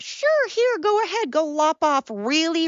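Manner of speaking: high, mock-breezy go-ahead; sarcastic permission. Adult mockingly giving permission to someone whose brain is not formed, sarcastic. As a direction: put on a high, airy voice of false approval.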